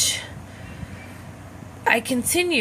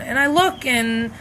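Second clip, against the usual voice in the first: usual speaking voice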